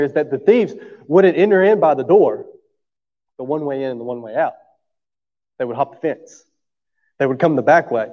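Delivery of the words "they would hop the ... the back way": ordinary talk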